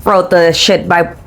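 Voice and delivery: ordinary delivery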